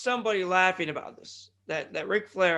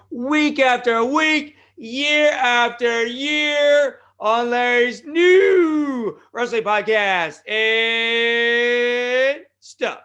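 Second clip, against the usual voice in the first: louder, higher, slow announcer build; hype shout; 4 semitones higher, 8 dB louder, much slower than usual. Real, not acted. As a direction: sell the podcast like a ring announcer. Hyping the show.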